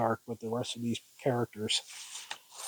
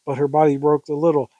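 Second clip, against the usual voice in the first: plain reading voice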